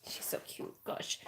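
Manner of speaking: unvoiced, hushed whisper. Real, not acted. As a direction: whisper so the puppy keeps still. Whispering about puppy.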